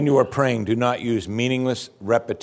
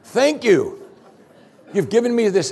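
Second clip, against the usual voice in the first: mock surprised God; 8 semitones higher than usual. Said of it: "It's just like".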